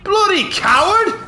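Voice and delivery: "Bloody coward!" stretched slow and high, the last word rising; drawn-out jeer. Pretend. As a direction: drag out "Bloody coward!" to mock the coward. Repeating the insult, drawn out.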